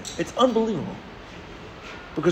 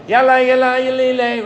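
higher and louder festive chant; chanted ululation; 7 semitones higher, 5 dB louder than usual. Shown as a demonstration. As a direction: sing out the celebratory call. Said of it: "That's what it's called".

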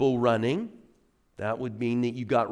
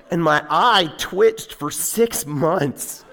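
laughing; 4 semitones higher, 6 dB louder, slower than usual. Real, not acted. Laughing at own spider story.

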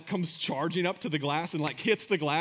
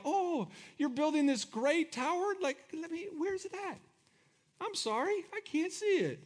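mocking; 9 semitones higher than usual. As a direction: feign trouble spotting something tiny. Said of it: "He's totally mocking them".

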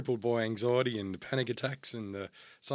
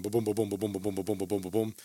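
pounding heart noise in a rapid even run; relentless thumping "ba-boom" loop; imitated heartbeat. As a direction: mimic a racing heartbeat. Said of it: "But the body's going".

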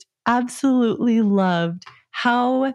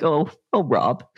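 mock sigh; 5 semitones lower than usual. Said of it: "if I'm ever like".

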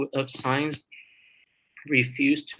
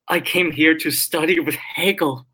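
loud, higher-pitched dismayed outburst; retold outburst, raised in pitch and volume; blurted out; 4 semitones higher, 6 dB louder than usual. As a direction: blurt it out, imitating a tactless student. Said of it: "blurted out".

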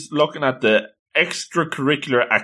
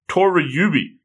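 ordinary delivery, nothing marked

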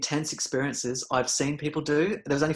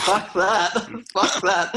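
high, loud, half-laughing "no way"; laughing mock panic at the thought; laughing refusal; 6 semitones higher, 8 dB louder, much slower than usual. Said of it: "it's like".

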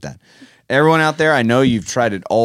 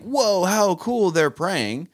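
mock admiration; 8 semitones higher, slower than usual. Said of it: "others can see us and think".

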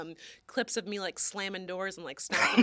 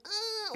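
imitating a whimper; 11 semitones higher, 3 dB quieter than usual. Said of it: "she would be like".

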